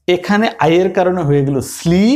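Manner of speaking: normal talking voice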